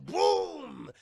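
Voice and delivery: loud, drawn-out boom noise; long comic crash sound; imitated impact. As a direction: boom it out like a monster landing. Imitated crash of monster landing.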